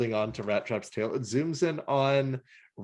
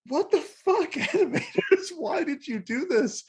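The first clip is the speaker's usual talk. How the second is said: much higher, incredulous cry at the animators; mock outrage; 13 semitones higher than usual. The reviewer yelling at the animators, high and incredulous. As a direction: throw up your hands at the animators, high and baffled. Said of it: "and I'm like".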